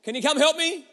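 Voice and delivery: put-on high, loud child calling out; child shouting. Daughter calling daddy from the backyard, high loud child shout. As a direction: call across the yard like a little girl. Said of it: "she shouts".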